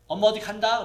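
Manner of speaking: put-on teasing a toddler. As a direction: tease the little kid that mommy's leaving.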